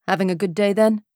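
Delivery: put-on casual question in a British accent; lightly voiced British man making conversation. A narrator's reading. Stranger at the bar teases the writer, British accent. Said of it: "that lovely British accent".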